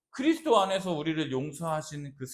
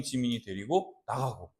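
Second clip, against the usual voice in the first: usual speaking voice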